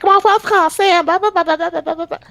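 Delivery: high-pitched mock nagging babble; mocking language purist. Mimicking irritating French-language sticklers, high mocking babble. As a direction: rattle off blahs in mocking high voice. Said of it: "they're the type of person".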